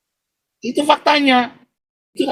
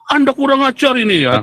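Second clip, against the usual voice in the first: angry lawyer; 9 semitones higher than usual. Retold or imitated. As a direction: act out the lawyer snapping at him. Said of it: "Oh, dia marah".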